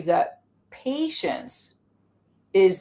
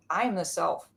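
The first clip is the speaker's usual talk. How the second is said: calm self; 4 semitones lower, 9 dB quieter than usual. Acted. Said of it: "And I'm like".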